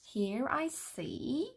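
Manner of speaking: usual speaking voice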